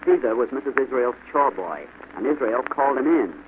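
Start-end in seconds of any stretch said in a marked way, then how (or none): none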